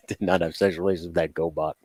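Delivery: fast low robotic voice; clipped robot delivery. Retold or imitated. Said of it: "the robot voice".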